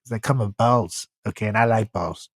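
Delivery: mock president boasting, loud then dropping down; Donald Trump impression. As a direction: brag like Trump at a podium, pushing it out. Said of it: "He'll say".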